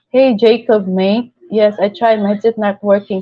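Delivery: usual speaking voice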